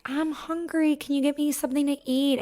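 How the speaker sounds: put-on high-pitched whiny kid voice; whining toddler. Toddler begging mom for food, high whine. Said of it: "whining, you know, as toddlers do in that, that whining voice".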